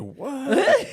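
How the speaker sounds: high shocked "What?" with a laugh. Retold or imitated. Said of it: "they was like".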